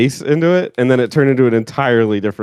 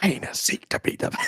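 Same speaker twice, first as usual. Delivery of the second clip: pirate-like accent; 8 semitones higher, 9 dB quieter than usual. Shown as a demonstration. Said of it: "You sound like a pirate".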